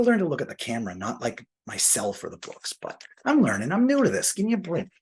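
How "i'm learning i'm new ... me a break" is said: high, mock-whiny excuse; playful plea. Real, not acted. New vlogger teasing viewers for a break, mock-whiny. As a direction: beg the viewers to go easy on him, teasing.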